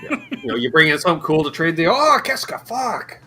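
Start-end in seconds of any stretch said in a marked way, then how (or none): 1.9-3.2 high, slow French Canadian outburst; mock French Canadian. Clerk reacting to a trade, French Canadian accent. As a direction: ham up a Quebec clerk's slow swear. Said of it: "broken French Canadian accents".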